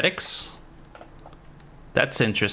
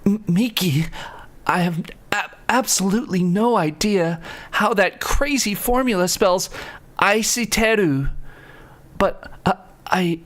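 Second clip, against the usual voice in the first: Deku voice; 8 semitones higher than usual. Acted. Reading a lovers' note aloud in a high anime boy voice. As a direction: play the flustered anime hero. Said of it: "should I do my Deku voice".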